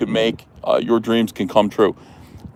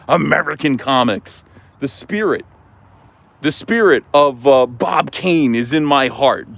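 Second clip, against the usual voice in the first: ordinary delivery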